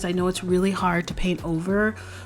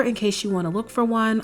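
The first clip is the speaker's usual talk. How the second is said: normal talking voice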